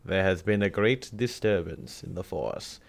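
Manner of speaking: low, slow sinister emperor; Emperor Palpatine voice. Retold or imitated. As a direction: rasp it out like the Emperor. Palpatine quote acted to Vader.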